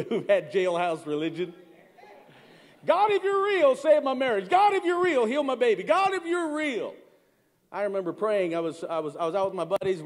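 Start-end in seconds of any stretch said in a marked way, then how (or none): 2.9-6.9 desperate pleas. Retold or imitated. Voicing desperate prayers.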